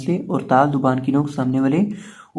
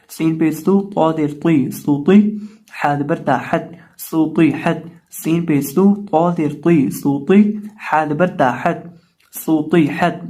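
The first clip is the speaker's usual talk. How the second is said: spelling recitation drill; slower than usual. Shown as a demonstration. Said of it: "spelling drill".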